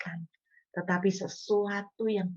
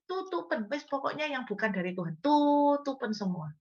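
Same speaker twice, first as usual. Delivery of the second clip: put-on old desperate prayer; 8 semitones higher than usual. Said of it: "Dulu saya juga doanya kayak gitu".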